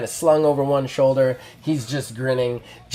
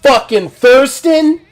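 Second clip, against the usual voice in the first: higher, much louder indignant yelling; furious wrestler rant; 8 semitones higher, 8 dB louder than usual. Acted. Heel wrestler raging at a silent bald rival, loud and furious. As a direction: scream it like an outraged heel.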